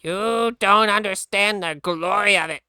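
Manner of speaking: very high, loud squeaky ranting; tiny dwarf raging in a squeak. Acted. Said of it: "a squeaky voice".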